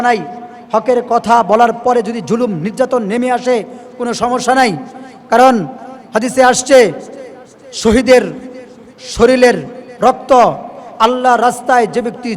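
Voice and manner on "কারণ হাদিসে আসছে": ordinary delivery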